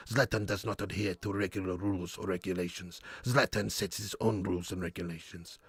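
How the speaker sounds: low, quiet, self-important Swedish voice; deadpan, accented boasting in the third person; Zlatan Ibrahimovic. Acted. Streamer showing off his footballer impressions, self-important Swedish accent. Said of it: "I can do a few manager impressions and stuff, or football impressions".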